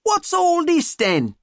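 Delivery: high-pitched mock cockney; exaggerated cockney accent; hammed-up cockney. A narrator's reading. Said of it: "common or garden English accent".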